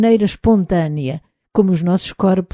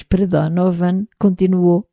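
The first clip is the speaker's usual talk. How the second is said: ordinary delivery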